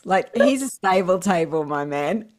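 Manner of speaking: ordinary talk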